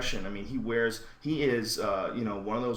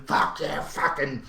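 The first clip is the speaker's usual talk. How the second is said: imitated victory yell, higher and louder; mock screaming; 5 semitones higher, 5 dB louder, slower than usual. Imitating an angry winner to the camera, mock screaming. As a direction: mimic a raging fighter yelling at the camera. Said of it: "starts screaming".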